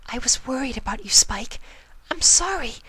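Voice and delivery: near tears, sniffling; tearful Fluttershy. A narrator's reading. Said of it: "the sniffling mare".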